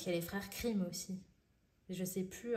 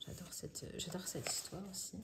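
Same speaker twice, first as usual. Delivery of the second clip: whisper; 8 dB quieter than usual. Real, not acted. Hushed aside about a favourite tale.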